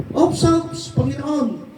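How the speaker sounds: high, squeaky interjection; mock protest. Satan cuts in on the Lord with a high mock protest. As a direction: jump up high in pitch like a sly objector.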